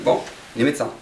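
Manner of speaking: ordinary delivery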